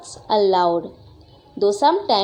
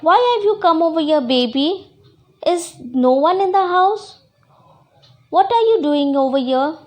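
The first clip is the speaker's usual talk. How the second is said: put-on higher, slightly louder childlike complaint; acted annoyed scolding; 4 semitones higher, 4 dB louder than usual. Jealous girl tells baby sister to go away, acted annoyance. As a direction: shoo someone off, crossly pleading.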